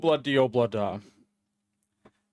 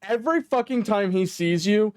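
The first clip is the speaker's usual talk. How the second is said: ordinary talk, nothing marked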